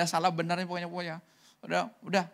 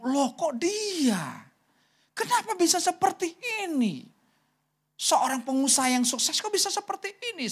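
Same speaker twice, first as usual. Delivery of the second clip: shocked disbelief; 10 semitones higher than usual. Retold or imitated. Preacher spotting the ruined businessman, retold in high astonishment. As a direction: wail it in high-pitched disbelief. Recognises a businessman fallen low.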